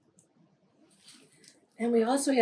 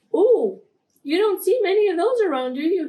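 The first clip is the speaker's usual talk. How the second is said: high, loud, small-mouthed squeak of a voice; tiny-mouthed frog; 8 semitones higher, 5 dB louder than usual. Acted. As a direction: pucker up and squeak it out fast. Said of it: "puckered his lips and made his mouth as small".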